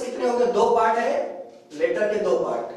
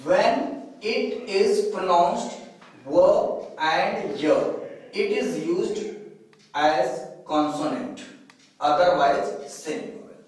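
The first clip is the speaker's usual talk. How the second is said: slow dictation; 2 semitones lower, much slower than usual. Shown as a demonstration. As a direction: read the rule out slowly, word by word. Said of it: "फिर से देखें". (धीमे-धीमे नियम पढ़ते हुए)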